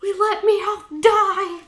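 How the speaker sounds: mock despairing outcry; panicked wail. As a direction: wail it like the worst just happened. Pikachu panics over dead Meowth.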